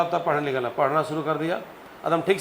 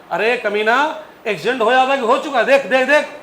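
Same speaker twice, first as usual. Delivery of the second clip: higher, louder, fast scolding; old uncle yelling at the driver after braking; mock angry old man; 6 semitones higher, 6 dB louder than usual. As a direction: rant like an injured uncle at the driver.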